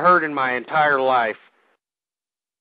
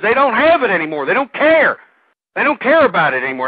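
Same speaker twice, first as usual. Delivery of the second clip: angry rant; 5 semitones higher, 6 dB louder than usual. Real, not acted. Host ranting about Republicans.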